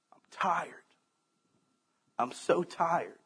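quiet, broken whisper of a tired man; tearful whisper. Retold or imitated. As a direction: whisper it as if near tears, slow. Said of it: "He teared up and he goes".

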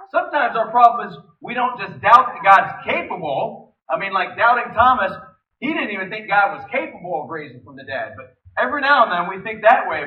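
loud, driving preacher voice; full-force sermon delivery; preaching. Acted. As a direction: preach it hard to the congregation.

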